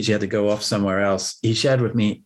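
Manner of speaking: ordinary talk, nothing marked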